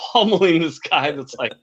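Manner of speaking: ordinary delivery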